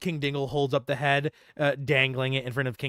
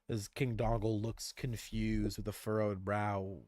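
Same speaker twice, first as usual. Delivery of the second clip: ordinary delivery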